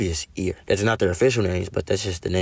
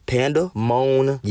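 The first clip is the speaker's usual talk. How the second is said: slow, slightly raised, word in pieces; sounded out; 4 semitones higher, slower than usual. Shown as a demonstration. Giving the example words in your cranium, slowly sounded out.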